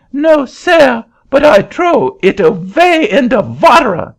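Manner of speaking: put-on very high, loud French-accented protest; an indignant Frenchman in a shrill-high French accent; angry French accent. Frenchman refusing the auctioneer's seat, high loud French accent.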